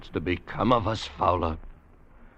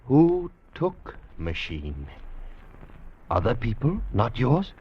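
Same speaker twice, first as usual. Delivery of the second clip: slow simple speech; slower than usual. Acted. Slow pidgin to Weena.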